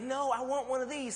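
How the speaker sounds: put-on high child's voice begging; whiny little boy. Son begging dad for the dart gun, high and whiny. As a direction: plead like a kid spotting a toy. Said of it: "And he's like".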